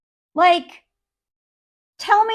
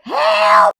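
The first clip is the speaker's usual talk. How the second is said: very high, loud cry; mock panic; 14 semitones higher, 5 dB louder than usual. Panicking wife calling for rescue, high mock scream. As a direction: call out in hammed-up terror. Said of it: "complete state of panic".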